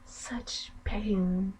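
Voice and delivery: slow, soft, gloating whisper; creepy whisper. Acted. Sister gloating to brother about his dead rat, whispered. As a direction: savour each word in a hush.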